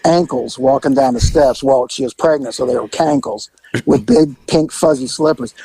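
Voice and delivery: ordinary delivery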